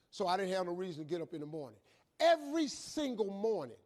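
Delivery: ordinary talk, nothing marked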